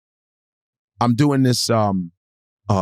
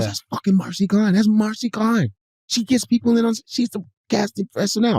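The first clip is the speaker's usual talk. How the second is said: higher hushed gossip voice; imitating a woman; 9 semitones higher than usual. Woman at the table whispering who Marcy Klein is. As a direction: lift the pitch, tell it like juicy gossip. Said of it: "She goes".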